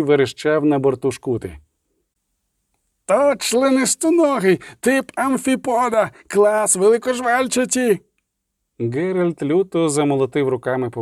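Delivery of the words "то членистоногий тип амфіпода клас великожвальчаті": high, slow, eager academic voice; nerdy scholar's high excited voice. Acted. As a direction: lecture the creature's name in wonder from high up. Excited scholar shouting facts.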